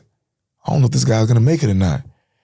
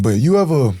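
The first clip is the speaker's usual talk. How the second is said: normal talking voice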